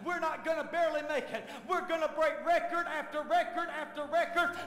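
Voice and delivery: loud, high, shouted declaration; full-force preaching. Real, not acted. Preacher declaring breakthrough over the church, full-force shouting. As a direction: preach it out to the back door.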